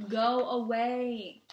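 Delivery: slow, loud, drawn-out brush-off; angry dismissal. A narrator's reading. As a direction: send them away, stretching each word. Boy brushes off scolding girl.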